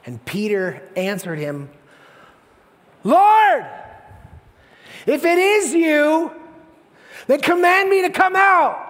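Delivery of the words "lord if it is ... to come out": high, loud cry; shouting over storm. Acted. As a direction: call out at full force to someone far off. Peter yelling over the storm.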